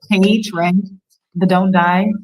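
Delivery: usual speaking voice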